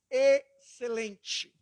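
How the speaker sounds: Excelente stretched very slowly; very slow emphatic Excelente; drawn-out excellent. Acted. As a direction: draw out Excelente, each syllable held. Answer repeated a third time.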